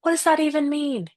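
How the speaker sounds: a lightly voiced, exasperated what-does-that-mean; exasperated retort. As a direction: scoff at the empty comfort. Exasperated reply to platitude.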